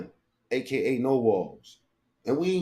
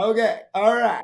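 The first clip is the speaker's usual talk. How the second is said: mock chirpy okay; 7 semitones higher than usual. Mimics clip.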